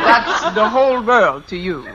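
self-satisfied mock-German teacher; put-on German accent. Fake teacher bragging to the principal, put-on German accent. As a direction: boast in a hammed-up German accent. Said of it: "If I can do a decent German accent".

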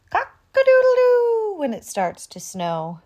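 high, loud crow; rooster crow. A narrator's reading. Narrator crowing loudly for the rooster. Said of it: "says the rooster".

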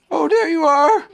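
high, loud bear call; Pooh calling down. A narrator's reading. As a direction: call down to a returning friend. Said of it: "called down Winnie the Pooh".

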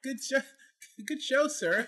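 high, hammed-up posh approval; posh toff voice. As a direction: play a stuffy upper-class gent. Mocking posh toff praise.

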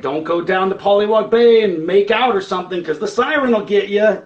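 loud, high spooky-tale warning; playful ghost-story caution; mock warning. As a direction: warn them off, hammed up. Acted joke warning about the siren.

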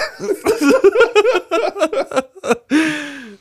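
loud, high, helpless cackling; real uncontrollable laughter; howling laughter. Real, not acted. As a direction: double over laughing. Laughter continues.

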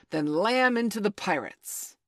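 high, loud rallying order; shouted attack command; battle cry. A narrator's reading. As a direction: send the gang charging.

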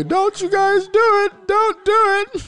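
high, loud, slow shrieked denial; hammed-up hysterical wail, pitched high; mock screaming plea. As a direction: screech it like a terrified man pleading.

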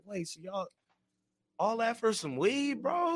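incredulous. Retold or imitated. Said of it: "I was still like".

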